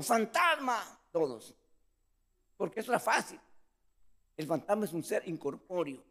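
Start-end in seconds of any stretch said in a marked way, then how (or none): 0.0-1.0 high, startled shout of ghost; frightened cry. Retold or imitated. Disciples see Jesus on water, startled high cry. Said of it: "y dijeron".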